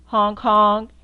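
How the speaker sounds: loud, blaring honks; loud horn honk. Acted. Reading to a child, honking the jeep horn loudly.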